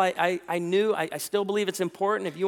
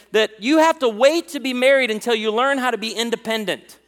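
loud, raised-pitch sermon delivery; full-force preaching; 5 semitones higher, 8 dB louder than usual. Real, not acted. Preacher urging young people toward marriage, loud full-force preaching. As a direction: preach it hard to the room.

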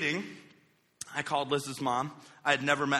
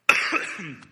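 cough; 5 dB louder than usual. Real, not acted. Cough before sermon.

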